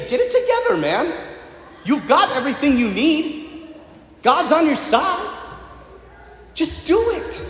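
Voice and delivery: high-pitched, insistent pep talk; urging. Retold or imitated. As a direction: exhort a friend to pull himself together. Voices Paul urging Timothy.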